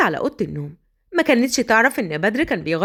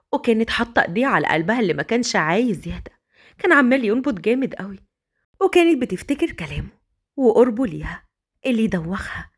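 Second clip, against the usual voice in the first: usual speaking voice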